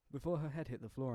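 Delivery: ordinary delivery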